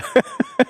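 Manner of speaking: short high giggle; a quick squeaky chuckle; high laugh. Real, not acted. Students joke, teacher laughs.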